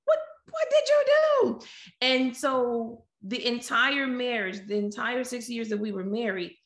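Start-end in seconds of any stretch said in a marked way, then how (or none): 0.1-1.6 very high mock squeal of disbelief; dismayed self-questioning. Wife realising she is stuck, dismayed self-questioning. Scolds herself in retold dismay.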